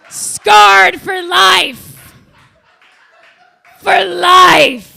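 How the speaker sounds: loud, high, slow dramatic yell; mock anguished yell. Comic reflecting on childhood wound, hammed-up loud wail. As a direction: ham up the lifelong trauma.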